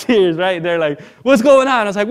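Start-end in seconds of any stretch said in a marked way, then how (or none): 1.2-1.8 loud, high-pitched demand; raised voice, mock alarm; retold alarmed question. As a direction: imitate worried friends wanting answers. Friends demand to know, retold.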